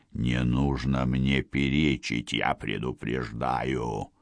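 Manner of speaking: low, slow, quiet villain warning; menacing threat. Acted. Murderer threatens drowning countess.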